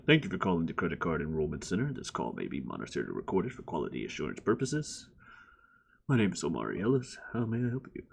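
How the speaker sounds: low, quick scripted phone greeting; deep fast customer service script; call center voice. Shown as a demonstration. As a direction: read the hold line like a bored agent. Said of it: "For example".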